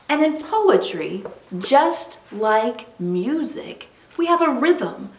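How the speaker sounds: normal talking voice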